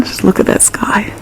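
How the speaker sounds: soft hushed whisper. Real, not acted. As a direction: breathe it out in awe. Quiet awe at the sky outdoors.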